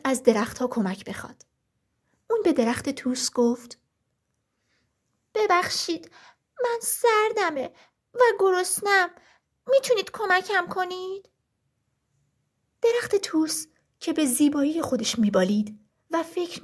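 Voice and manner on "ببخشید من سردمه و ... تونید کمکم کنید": high, small pleading bird voice; a tiny cold bird begging for help; little lark voice. Acted. The little lark begs the birch tree for help, high and pleading.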